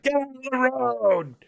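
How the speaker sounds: high, slow, stretched cop order; high mock police order; imitating the cop. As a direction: bark it like an annoyed cop. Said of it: "he's like".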